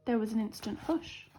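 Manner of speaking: usual speaking voice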